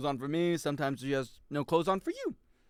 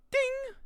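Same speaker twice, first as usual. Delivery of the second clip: very high sung chime; playful pop-up chime sound; imitated ding. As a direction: ring out one high bell note.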